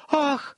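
very high "ah" of fright; high-pitched feminine gasp-cry; woman's startled shriek. A narrator's reading. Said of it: "вскрикнула Верета".

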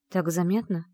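ordinary delivery, nothing marked

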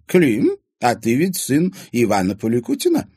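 put-on curious old lady. A narrator's reading.

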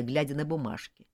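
ordinary delivery

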